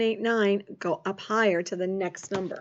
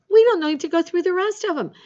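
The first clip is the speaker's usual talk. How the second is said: delighted; 7 semitones higher, 4 dB louder, faster than usual. Real, not acted. Pupil answers early, teacher delighted.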